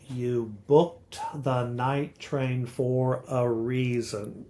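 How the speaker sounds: slow "you BOOKED the NIGHT train FOR a REAson"; iambic stress. Shown as a demonstration. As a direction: spell out the beat of "you booked the night train for a reason", accenting every other syllable. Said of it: "accenting every other syllable".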